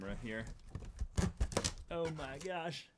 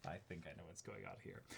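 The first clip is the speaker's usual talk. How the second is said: hushed; 6 semitones lower, 17 dB quieter than usual. Real, not acted. Repeats realization.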